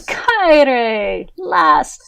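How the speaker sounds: loud, high-pitched teasing welcome; exclaimed greeting. Acted. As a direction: hail an old friend with mock exasperation. Barkeep greets her troublesome friend.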